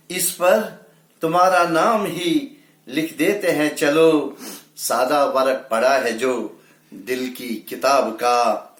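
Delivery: lifted, emphatic ghazal opening easing down at the close; mushaira-style recital; poetry recitation. Acted. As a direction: declaim the couplet to the audience. Repeats the couplet with lift.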